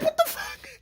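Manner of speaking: very high, squeaky disbelief; hammed-up shock. Brother appalled at little sister's insult, high squeal of shock.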